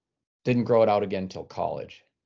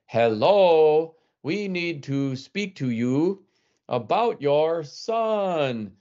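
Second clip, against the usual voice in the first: loud and very slow, talking down to a parent; over-enunciate as if volume fixes language; 7 semitones higher, 4 dB louder, much slower than usual. Retold or imitated. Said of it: "speaking very loudly and very slowly".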